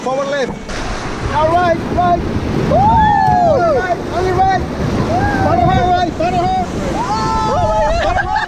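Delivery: loud, high yelled commands over rapids; shouting commands. Real, not acted. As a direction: bark paddling orders at full voice. Guide yelling commands in rapids.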